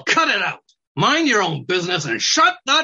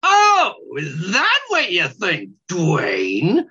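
snide; 4 semitones higher than usual. Acted. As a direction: taunt him like a snide weasel. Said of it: "a tone that was snide".

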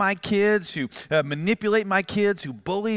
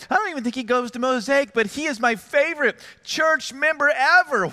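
excited, higher-pitched raving; gushing praise; 6 semitones higher than usual. Retold or imitated. Pastor raving to wife about a parent who helped, high-pitched gushing.

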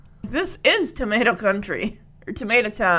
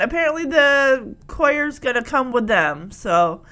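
usual speaking voice

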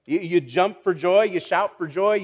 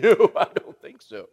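mock dismissive retort with a laugh; the church laughing off Paul's command; laughing refusal; 5 dB louder than usual. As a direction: laugh it off, brushing the command away. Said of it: "And they said".

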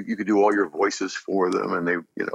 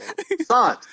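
Lion-O voice; slower than usual. Pretend. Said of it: "croissant when Lionel's voice".

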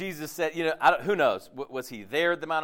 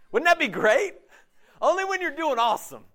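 sarcastic; 11 semitones higher, 6 dB louder than usual. Real, not acted. Sarcastic jab at conditional grace.

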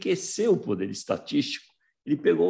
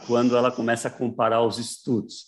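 ordinary delivery

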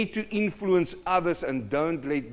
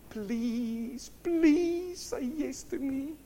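high, drawn-out mock begging; pleading; 10 semitones higher, much slower than usual. Preacher mimicking God begging to be chosen, pleading. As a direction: plead sweetly in a thin high voice, slowly.

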